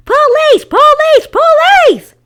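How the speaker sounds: shrill, very high squawking cry of a parrot; parrot shouting. Acted. The parrot Petra squawks an alarm at the intruder, screeching. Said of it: "Petra replied to her friend".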